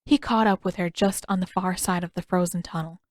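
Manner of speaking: plain reading voice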